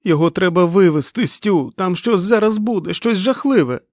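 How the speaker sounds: high, loud, frantic plea; panicked cry. A narrator's reading. Fran begs Stu to get Nick out, panicked cry. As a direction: plead in terror.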